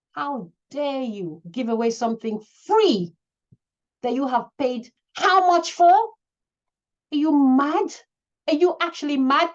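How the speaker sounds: loud, scolding challenge; shouting. Real, not acted. Coach berating students about giving work away, shouting. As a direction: shout the questions at them. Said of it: "I'm sorry I'm shouting".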